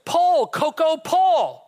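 high, loud hollered command; farmer's yell. Retold or imitated. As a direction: holler it to a horse, stretching the words. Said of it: "the farmer yells out again".

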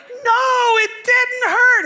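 put-on shrill, loud whining no, like a crying baby; high wailing cry. Answering his wife, weeping like a baby over a dropped hammer.

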